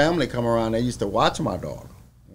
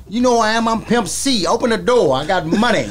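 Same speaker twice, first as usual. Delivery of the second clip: louder, higher swaggering demand; 10 semitones higher, 7 dB louder than usual. Retold or imitated. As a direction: bark it at the security guard. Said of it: "he didn't change his character".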